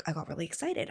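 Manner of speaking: normal talking voice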